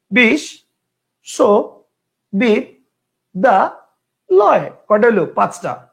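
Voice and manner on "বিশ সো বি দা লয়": বিশ, সো, বি, দা, লয় said as five separate beats; split into syllables. Shown as a demonstration. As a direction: chop বিশ্ববিদ্যালয় into five syllables with pauses. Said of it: "কেউ উচ্চারণ করতো". (পাঁচ ভাগে ভেঙে থেমে থেমে উচ্চারণ)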